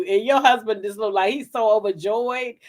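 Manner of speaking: usual speaking voice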